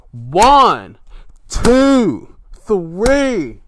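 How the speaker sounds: big, loud, spaced-out count; emphatic high-pitched shout on each number; referee's count. Retold or imitated. Acts out the pinfall count.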